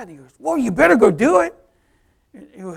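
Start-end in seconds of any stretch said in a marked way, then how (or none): 0.4-1.5 high, loud friendly warning; urging. Retold or imitated. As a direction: push him to act, voice raised. Friend urging him, acted.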